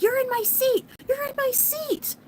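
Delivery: high-pitched mock yell of an angry woman; shrill it out like the lady who wants her seat. Imitating the lady yelling at her husband over a seat, high mock yell. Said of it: "she's yelling at my husband".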